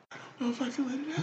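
high, soft fake sobbing voice; mock crying. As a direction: pretend to cry through the words. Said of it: "clearly he's crying".

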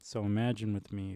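ordinary talk

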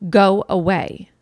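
loud, slow mock yell; imitated child's scream. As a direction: drag out an irritated child's yell, short of screaming. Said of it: "screams at you".

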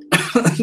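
sharp cough after a drink; coughing on water down the wrong pipe; choking cough. Real, not acted. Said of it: "went down the wrong pipe".

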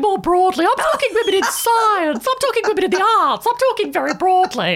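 put-on high, prim old lady voice. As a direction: play a fussy elderly lady. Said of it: "I might speak with this voice".

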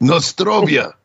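mock Russian toast; hammy Russian accent. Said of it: "you're speaking Russian".

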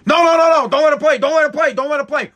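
high, loud frantic begging; a mock-panicked husband shouting no; panicked pleading. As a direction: plead desperately for them to stop it. Begging phone company to stop message.

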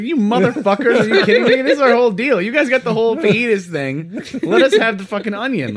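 high, exasperated, laughing protest; hammed-up outraged complaint; mock outrage. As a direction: play indignant and cheated, pitch jumping up. Acted outrage of rival restaurant.

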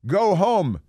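high, loud mock yell; all-caps shout. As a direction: shout it like an email in capitals. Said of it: "And then in all caps".

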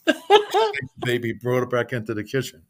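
ordinary delivery

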